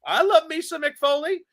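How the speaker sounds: Cornette impression. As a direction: gush like Cornette in a high voice. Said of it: "He's like".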